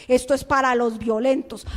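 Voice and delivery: ordinary delivery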